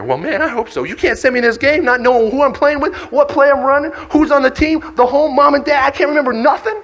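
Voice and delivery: high, loud, mounting alarm; panicked protest. Acted. Player told his memory will go, panicked protest. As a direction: squawk in disbelief, rising as you go.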